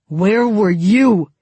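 slow, loud, menacing snarl; angry snarl. A narrator's reading. Tolia pins Mal to the wall, snarling slowly. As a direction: snarl each word slowly, in his face. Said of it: "he snarled into Mal's face".

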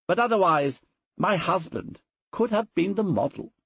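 mistress of the house. Acted. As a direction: lift the voice high for the mistress. Portia compares statue and husband.

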